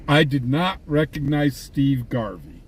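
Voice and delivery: usual speaking voice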